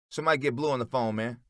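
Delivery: urgent narrated holler. A narrator's reading. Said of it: "Meech hollers".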